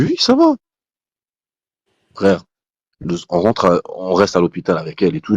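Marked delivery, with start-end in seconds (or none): 0.0-0.5 high-pitched reassurance. Retold or imitated. Wife asks if he is okay.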